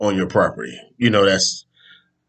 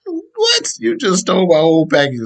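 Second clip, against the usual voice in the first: a high, incredulous "What?" that settles into a mock scold; outraged disbelief; 10 semitones higher than usual. Homeowner confronting the thief, incredulous mock outrage. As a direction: play the homeowner who can't believe the nerve.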